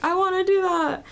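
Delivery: high, eager mock yearning; eager longing. Seminar attendee retelling her new ambition, eager and high.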